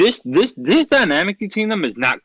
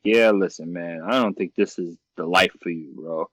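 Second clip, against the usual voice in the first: lower, dismissive rival talking down to Bruce; rival's voice; 8 semitones lower, 4 dB quieter than usual. Retold or imitated. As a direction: drop the voice low and brush him off.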